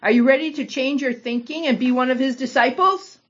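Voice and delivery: mock them gently as you ask; a touch of sarcasm. Healed man needling the Pharisees, a touch of sarcasm. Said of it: "replied with a touch of sarcasm".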